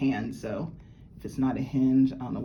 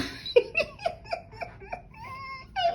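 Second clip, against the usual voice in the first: very high laugh close to crying; tearful happy laugh; 3 dB quieter than usual. Real, not acted. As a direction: laugh through happy tears. Bracelet finally fits.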